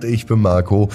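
ordinary delivery, nothing marked

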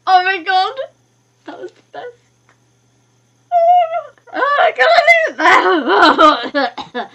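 loud squeal dropping into laughter; squeal, then laugh in disbelief; real overwhelmed delight. Real, not acted. Player overwhelmed by the ending, squealing with disbelief.

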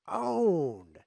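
loud, high, drawn-out crow; gloating, stretched-out shout; triumphant taunt. Real, not acted. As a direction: call it out like a victory cry. Crows over the won queen.

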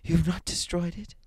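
put-on high, urgent old woman; frantic old woman. A narrator's reading. Frantic wife voiced high.

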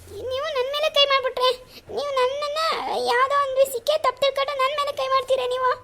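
high-pitched tearful reproach. Acted. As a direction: cry the words out in a high, hurt wail. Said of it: "ಅಮ್ಮ, ಅಮ್ಮ, ಯಾಕೆ ಅಳ್ತಿದ್ಯಾ?".

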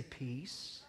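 very soft, almost breathed "Peace."; calm peace. Shown as a demonstration. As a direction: say "Peace." softly, as if to yourself. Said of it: "He didn't just sit there and say".

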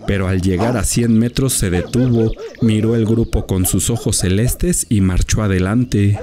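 ordinary delivery, nothing marked

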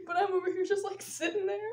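usual speaking voice

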